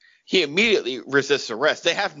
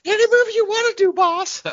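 squeaky high, fast and louder; eager puppet voice; 4 dB louder than usual. Pretend. Puppet answering its boss, high and eager. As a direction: gush it like a dummy obeying. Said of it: "ventriloquist puppet".

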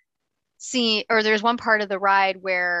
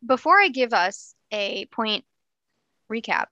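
ordinary talk, nothing marked

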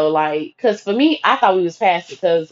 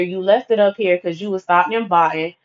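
ordinary talk, nothing marked

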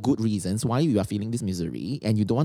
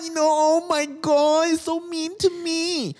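much higher, slow, hammed-up whine; mock whiny complainer; slower than usual.

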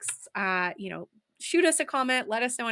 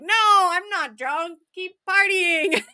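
put-on drunk teenager; 7 semitones higher, 6 dB louder, much slower than usual. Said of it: "And she'd be like".

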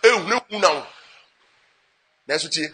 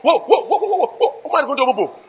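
mock panicked wailing; 6 semitones higher than usual. Preacher mimicking a terrified person running away, high mock panic. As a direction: act out someone fleeing in terror, hammed-up.

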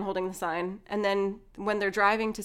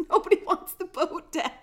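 higher, louder, laughing through the imitation; laughing retort; 7 semitones higher, 6 dB louder than usual. Daughter puts down her dad over the boat, laughing retort. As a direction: imitate a daughter's put-down while cracking up.

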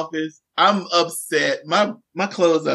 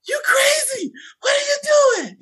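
shouting. Retold or imitated. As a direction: yell it up the tower. Teacher catching runaway on antenna.

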